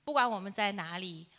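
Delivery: usual speaking voice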